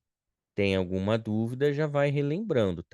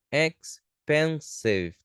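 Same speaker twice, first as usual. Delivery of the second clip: "Expensive" split slowly into three beats; syllable by syllable; much slower than usual. Shown as a demonstration. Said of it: "três sílabas".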